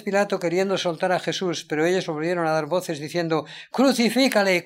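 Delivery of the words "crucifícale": loud, higher "Crucifícale" flung out by the mob; crowd cry. Acted. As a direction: call "Crucifícale" as an angry crowd demanding death.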